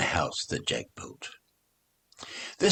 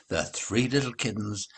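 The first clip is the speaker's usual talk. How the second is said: usual speaking voice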